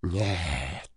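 low, hushed no; quiet refusal. A narrator's reading. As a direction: refuse softly and low, with menace held back. Villain refuses, first quietly. (тихий низкий отказ)

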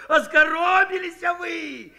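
high, slow, loud cry broken by laughs; shouting through laughter. A narrator's reading. Kondrat mocks the women while laughing, high and loud. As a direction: gasp it out between laughs. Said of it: "на силу выкрикнул".

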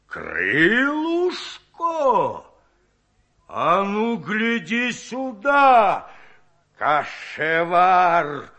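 slow, loud, furious roar; growling anger. A narrator's reading. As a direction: roar it at the cook, dragging each word. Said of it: "зарычал он".

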